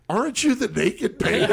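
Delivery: half-laughing, surprised as it dawns on her; surprised recognition. Retold or imitated. Nurse recognizing the patient, amused surprise.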